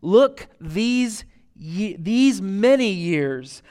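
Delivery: "Look, these many years" stretched very slowly, each word weighed; drawn-out emphasis. Shown as a demonstration. Preacher lingering on the son's reproach, very slow and weighted. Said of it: "Listen to his response".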